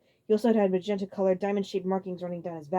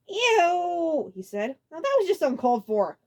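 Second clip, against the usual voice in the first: disgusted; 10 semitones higher, 6 dB louder, slower than usual. A narrator's reading. Roy disgusted.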